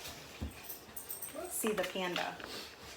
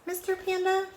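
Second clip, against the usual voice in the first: high mouse squeak; 11 semitones higher, 4 dB louder than usual. A narrator's reading. Mouse asks, pitch jumps.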